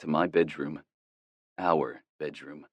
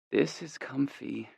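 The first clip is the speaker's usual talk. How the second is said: sleepy woman; 8 semitones higher, 6 dB quieter, slower than usual. A narrator's reading. Girlfriend drowsy in bed.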